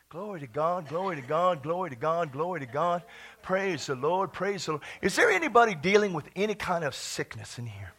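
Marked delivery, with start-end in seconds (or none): 0.1-4.8 low, soft repeated praise; quiet praise. Real, not acted. Preacher praising God after the shouting, quiet murmur. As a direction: murmur it reverently, low and rhythmic.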